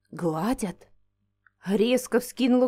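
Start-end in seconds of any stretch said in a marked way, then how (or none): 0.1-0.9 soft, low puzzled question; puzzled echo. Acted. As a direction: repeat it quietly in disbelief.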